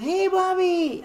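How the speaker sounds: high, slow, loud stretched call; drawn-out yell. Retold or imitated. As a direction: stretch the name out in a cheer. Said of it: "And everybody is".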